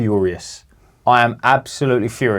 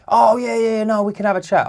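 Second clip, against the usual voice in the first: nervous staff voice; 8 semitones higher than usual. Retold or imitated. Mimicking flustered staff member.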